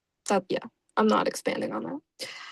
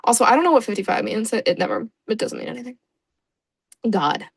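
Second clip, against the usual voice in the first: ordinary talk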